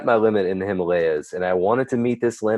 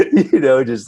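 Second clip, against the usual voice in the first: a laugh breaking through, higher and louder; 5 semitones higher, 6 dB louder than usual. Real, not acted. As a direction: laugh at how absurd it got. Laughing at his own ordeal.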